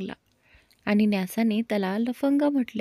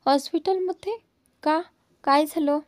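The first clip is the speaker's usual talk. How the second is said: high, startled question on हॉस्पिटलमध्ये; surprised; 5 semitones higher than usual. A narrator's reading. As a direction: catch her off guard with the news. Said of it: "नैना थोडी आश्चर्यचकित झाली". (आश्चर्याने विचारलेला प्रश्न)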